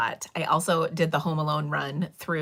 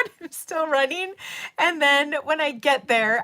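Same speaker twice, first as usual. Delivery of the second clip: excited; 12 semitones higher, 6 dB louder than usual. Retold or imitated. Excited retelling of airport run.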